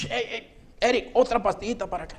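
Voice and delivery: high-pitched teasing call, no real shout; mock yelling. Teasing the boss about the pill, mock yelling. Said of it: "Cuando empezaba a gritar".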